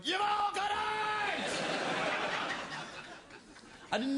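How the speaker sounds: high, slow, shouted in his head; remembered mock shout. Buddy's voice in his head, high slow shout. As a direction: yell it as the echo of a friend. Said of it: "Paul Byrne's voice going".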